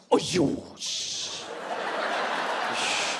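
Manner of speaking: unvoiced disgusted shushing scoff; disgusted Chinese scoff. Disappointed Chinese person scoffing at you. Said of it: "When you disappoint a Chinese person".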